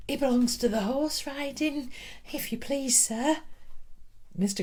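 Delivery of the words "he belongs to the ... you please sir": put-on higher, small girl's voice answering; a shy pupil speaking up; young girl addressing her teacher; timid schoolgirl.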